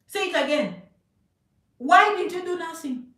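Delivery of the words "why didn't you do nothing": louder repeat. Real, not acted. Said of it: "[name], say it again".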